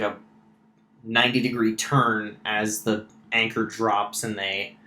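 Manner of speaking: normal talking voice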